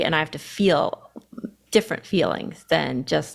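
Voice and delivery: ordinary talk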